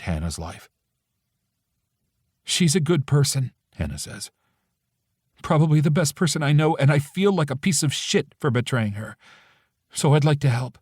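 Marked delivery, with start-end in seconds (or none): 2.4-3.5 lightly voiced friend. A narrator's reading. Hannah voice. 5.4-10.8 lightly voiced friend. A narrator's reading. Hannah voice.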